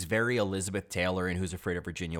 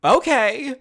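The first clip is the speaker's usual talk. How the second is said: "Okay." after a pause, much higher and louder; perfectly timed okay; 10 dB louder than usual. Retold or imitated. Retelling Helen Hunt's movie moment, imitating a high loud perfectly timed "Okay.". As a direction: land "Okay." as a mock punchline, high and loud after a beat. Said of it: "pause and this, like, perfectly timed".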